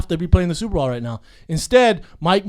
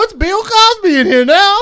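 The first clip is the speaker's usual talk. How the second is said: shrieking laugh line; 12 semitones higher, 5 dB louder than usual. Real, not acted. Shrieking comic realization.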